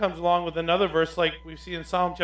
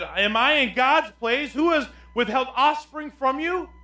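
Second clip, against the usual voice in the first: raised, higher pitched protest; angry Jacob; 10 semitones higher, 6 dB louder, slower than usual. Acted. Jacob snaps at Rachel in a raised angry voice. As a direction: demand it of her in anger. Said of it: "Jacob became angry with Rachel and said".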